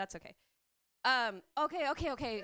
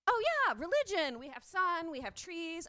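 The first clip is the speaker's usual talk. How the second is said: high, bright-eyed eager reply; hammed-up eager explaining; eager mock native; 6 semitones higher, 5 dB louder than usual. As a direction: act out an overeager, naive answer.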